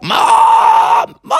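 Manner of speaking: piercingly high, scratchy call; strained, squawking frog shout; Froggy. Acted. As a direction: yell for Mom through a wrecked throat. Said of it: "the only thing his mom could hear downstairs".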